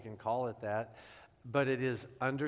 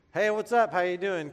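bright, higher, louder breezy hello; mock cheery greeting; 4 semitones higher, 6 dB louder than usual. Greeting future in-laws off the plane, breezy and loud. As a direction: play it breezy like nothing's wrong.

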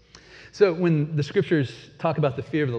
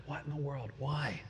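hushed disbelief; 13 dB quieter than usual. Retold or imitated. Sailors react to Jonah's confession.

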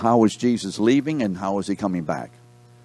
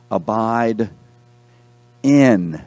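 pause, then "in" much louder; stress on IN; 4 dB louder, much slower than usual. Real, not acted. Preacher points class to a key word, stressing "in". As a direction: set "in" apart and hit it hard. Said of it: "circle the word in me".

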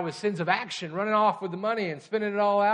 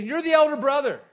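loud accusation; 9 semitones higher, 7 dB louder than usual. Retold or imitated. Jesus confronting the religious leaders.

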